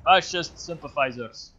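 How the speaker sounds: loud, booming Soviet officer; Soviet Commissar voice. Pretend. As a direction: thunder it like a political commissar. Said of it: "glorious Soviet Commissar voice".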